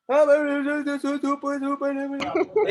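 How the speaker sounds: high, loud stumbling over the word; high-pitched comic struggle to read aloud; mock floundering oath-taker. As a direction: put on a squeaky, stuck attempt at a huge word.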